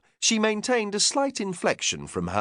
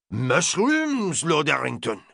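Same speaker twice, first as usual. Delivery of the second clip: put-on French accent, high offended mushrooms; indignant French chef; 4 semitones higher, slower than usual. Chef answering his lord indignantly, French accent. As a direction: bristle with French pride.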